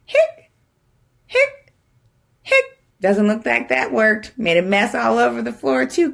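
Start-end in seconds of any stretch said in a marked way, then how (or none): 0.0-2.6 high squeaky hic hic hic; squeaky hiccups. Acted. Skeleton hiccuping to the kids, high squeaky hics.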